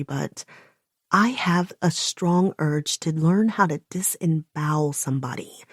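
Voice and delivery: usual speaking voice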